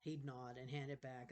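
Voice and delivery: usual speaking voice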